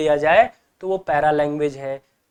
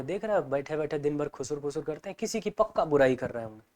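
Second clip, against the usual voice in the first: much softer, lower gossiping aside; gossipy murmur; 3 semitones lower, 10 dB quieter than usual. Retold or imitated. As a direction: tattle quietly so they won't hear. Gossiping about two whisperers.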